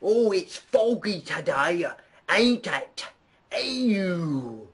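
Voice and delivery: hammed-up mock British, high and slow; exaggerated fake British accent, drawn out; bad British accent. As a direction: put on a silly cockney-ish British voice. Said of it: "do you wanna hear my British impression".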